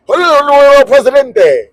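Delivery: high, loud garble with a drawn-out ending; mock rapid Spanish. Stranger repeats his announcement to baffled tourist, mock rapid Spanish. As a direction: babble it grandly, imitating a Spanish announcement. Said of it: "he says it again".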